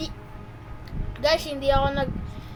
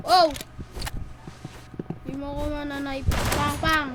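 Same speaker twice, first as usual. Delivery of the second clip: ordinary delivery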